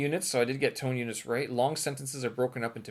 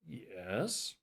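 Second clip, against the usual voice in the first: drawn-out YES with a steep rise; varied intonation; 6 dB quieter than usual. Shown as a demonstration. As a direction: turn "yes" into a doubtful question. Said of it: "as many ways as you can".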